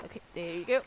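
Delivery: ordinary talk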